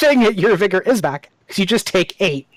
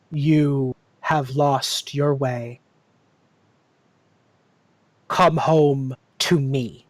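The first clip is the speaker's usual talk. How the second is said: very slow, measured summons; slow, slightly lowered otherworldly call; eerie tree voice; 3 semitones lower, slower than usual. Acted. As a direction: intone it slowly with long pauses. Eerie tree speaks through a face.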